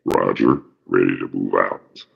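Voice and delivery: a cool, calm superhero reply; imitating Batman. Recalling Batman's smooth reply to Robin, a low, smooth hero voice. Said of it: "Then you hear Batman".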